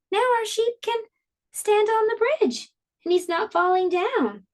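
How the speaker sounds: high, bright delight that the sheep stands; excited teacher. Acted. Teacher to class, thrilled the paper sheep stands.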